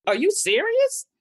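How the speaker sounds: very high, incredulous question; can't believe it, pitch shooting way up; retold incredulity. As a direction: treat it as a joke, high-voiced. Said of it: "it was such a joke to me".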